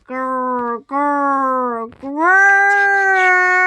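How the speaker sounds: rolling growls swelling to a long loud roar; grrr, grrr, then a big drawn-out one. A narrator's reading. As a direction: build each growl bigger. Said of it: "The growling got louder and louder".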